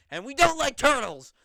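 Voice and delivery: high, loud mock scream. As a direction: yell it out across the backstage. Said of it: "he screams".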